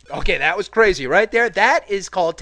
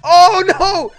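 high panicked screaming; scared horror game streamer; 13 semitones higher, 10 dB louder, slower than usual. Real, not acted. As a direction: scream as if chased. Chased by turtle.

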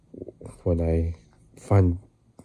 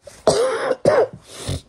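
cough; 4 dB louder than usual. Real, not acted. Sick vlogger coughing.

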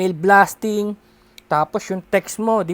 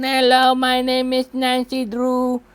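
same scam lady; 5 semitones higher than usual. Retold or imitated. Speaker mocking the same scam lady calling again, higher. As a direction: lightly mimic the lady reading her script. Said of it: "same lady na naman".

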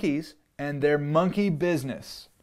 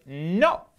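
short louder no; firm refusal; 3 semitones higher, 5 dB louder than usual. Acted. Max refusing the wild things, firm no. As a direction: refuse flatly like a stubborn kid. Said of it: "And Max said".

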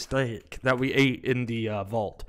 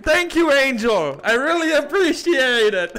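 higher and louder, over-the-top grateful; hammed-up character voice; 13 semitones higher, 10 dB louder, slower than usual. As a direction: belt it out like a thrilled kid. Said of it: "I'm getting really into his voice".

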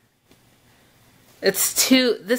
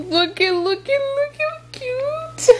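high-pitched squeal over cuteness; squealing with delight; excited gushing; 14 semitones higher than usual. Real, not acted. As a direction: gush higher and higher into a squeal. Gushing over the baby outfit.

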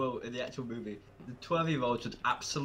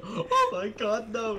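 high, drawn-out panicked plea; a hammed-up victim's cry, slow and high; mock horror; 9 semitones higher, 5 dB louder, much slower than usual. Victim as the monster crawls in the window, mock horror.

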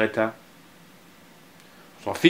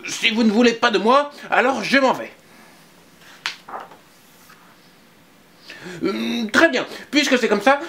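put-on high, louder huffy little boy; sulking child; 10 semitones higher, 3 dB louder than usual. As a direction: storm off in a huff. Anton leaves in a sulk.

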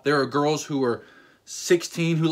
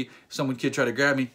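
normal talking voice

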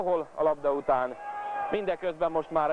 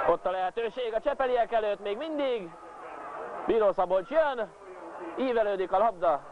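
excited commentary; 7 semitones higher than usual. Acted. Commentator during attack.

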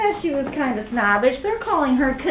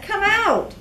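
raised, eager little caterpillar; Cornelius voice; 9 semitones higher than usual. Acted. Caterpillar coaxing his friend in a raised voice. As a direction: call her out of the egg, higher voice. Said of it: "he said".

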